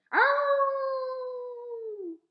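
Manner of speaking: loud high howling ow; dog howl. A narrator's reading. A dog howling outside the church, long loud howl. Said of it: "said Winn-Dixie".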